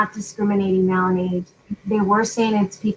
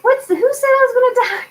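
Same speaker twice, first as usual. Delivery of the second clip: high, fast comeback; mock indignation; 13 semitones higher, 3 dB louder, faster than usual. Retelling her reply to worried daughter, mock indignant. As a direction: fire back in high-pitched disbelief.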